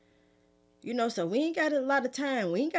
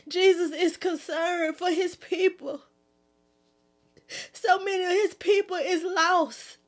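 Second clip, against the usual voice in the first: high, impassioned, urgent preaching, voice raised; emotional preaching; 8 semitones higher, 3 dB louder than usual. Real, not acted. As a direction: plead with the people to wake up, full of emotion. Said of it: "I got a little bit emotional".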